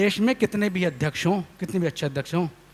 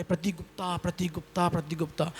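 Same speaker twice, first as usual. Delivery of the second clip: much softer repeated name, fading; mock echo; 10 dB quieter than usual. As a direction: imitate the name echoing back. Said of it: "आवाज़ लौट के". (नाम की धीमी गूँज की नकल)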